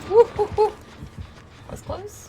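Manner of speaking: high squealing relief after a near miss; excited squeal. Real, not acted. Narrow escape in game.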